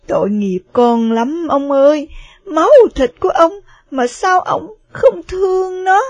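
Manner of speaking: pleading mother near tears; tearful plea. Acted. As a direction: beg him through tears to love the child. Mother pleads, crying for child.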